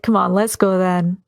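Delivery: ordinary talk, nothing marked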